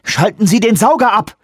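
high, loud command; urgent shout. Acted. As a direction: shout the order so it gets done now. Urgent order during landslide.

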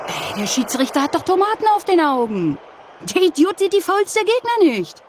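high, indignant fan rant; angry football fan. Acted. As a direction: rail at the referee from the stands. Fan ranting at referee.

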